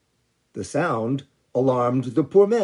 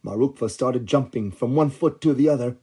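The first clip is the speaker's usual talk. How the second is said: usual speaking voice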